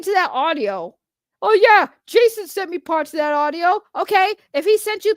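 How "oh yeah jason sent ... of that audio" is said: higher, mocking parody of Aaron's excuse. Ranting at Aaron, mimicking his audio excuse in a higher mocking voice. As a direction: throw his words back in a snide put-on voice.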